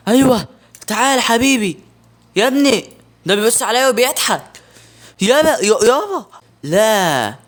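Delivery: high, loud calling to the enemy; excited taunting. Real, not acted. Gamer baiting the monster, high loud taunt.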